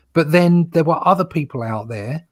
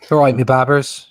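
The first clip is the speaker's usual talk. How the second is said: quick Bristolian greeting, broad and louder; Bristolian accent; 7 dB louder than usual. Retold or imitated. Joking guest doing the Bristol catchphrase in a Bristolian accent. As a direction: throw out a cheery Bristolian "all right, me babbers". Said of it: "That's how they speak down there".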